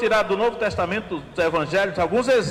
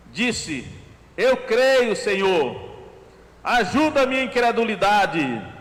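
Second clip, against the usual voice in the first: raised, earnest Bible reading; scripture reading; 4 semitones higher than usual. Acted. Preacher reading the father who cries out, earnest and raised. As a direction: read the father's cry with feeling. Reads Mark 9 passage.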